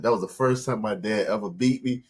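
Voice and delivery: normal talking voice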